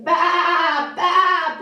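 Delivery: loud storybook baa; playful sheep call; sheep bleating. A narrator's reading. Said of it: "bleated the sheep".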